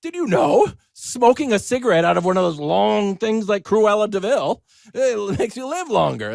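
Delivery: mock breathless headline; mock news hype. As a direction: sell the fake study like breathless news. Mocking another hyped claim.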